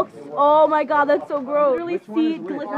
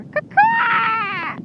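high squawked crow caw; mock bird cry; bird call; 6 semitones higher than usual.

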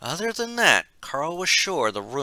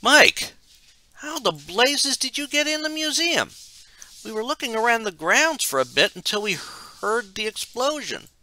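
put-on very high, incredulous young voice; astonished young man; 13 semitones higher, 4 dB louder than usual. A narrator's reading. Young man asks Mike how he got inside, high astonished voice. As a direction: pitch it way up as a startled teen friend.

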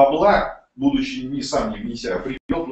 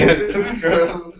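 a burst of amused laughter; short laugh; 5 semitones higher, 6 dB louder than usual. Real, not acted. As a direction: laugh out at the absurd reply. Laughs after reading message.

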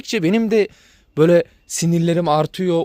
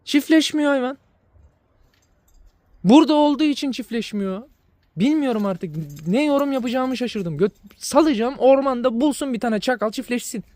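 exasperated rant; 7 semitones higher than usual. Real, not acted. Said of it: "sonradan tepem attı biraz".

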